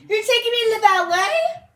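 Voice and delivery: put-on a little girl overjoyed it is the ballet; cried out in delight. A narrator's reading. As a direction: shout it with joyful disbelief. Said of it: "Olivia cried out".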